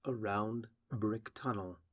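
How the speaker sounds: ordinary delivery, nothing marked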